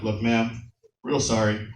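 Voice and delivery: low, soft sheepish apology; hushed apology. Retold or imitated. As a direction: murmur a sorry to her quietly.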